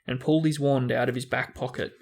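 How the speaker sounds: ordinary delivery, nothing marked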